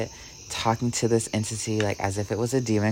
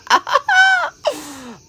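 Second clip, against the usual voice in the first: a squealing high-pitched giggle; 4 dB louder than usual. Real, not acted. Laughing to her audience about the romantic FaceTime, high giggle.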